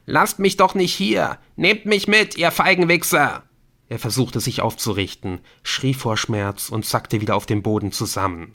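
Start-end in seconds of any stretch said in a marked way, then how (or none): 0.1-3.4 high, loud desperate yelling; yelling after them. A narrator's reading. Abandoned Colin yells after fleeing friends, desperate. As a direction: plead and curse at full voice. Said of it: "schrie Colin ihnen hinterher".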